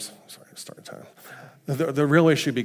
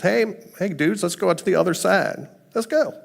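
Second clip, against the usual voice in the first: higher-pitched, upbeat call to buddies; casual rallying; 8 semitones higher, 4 dB louder than usual. Retold or imitated. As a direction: round up your friends to set off. Jesus rallying disciples, casually voiced.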